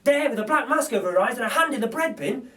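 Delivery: usual speaking voice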